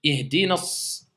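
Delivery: Ihdina s- with the s doubled and held; shidda shown. Shown as a demonstration. Teacher drawing out the doubled s of Ihdina s- for students. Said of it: "d'accord, il y a une shidda".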